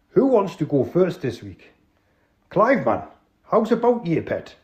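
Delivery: drawn-out Geordie host; Geordie accent. Acted. Tony checking the crooks have tea, Geordie. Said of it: "an authentic, uh, Northerner".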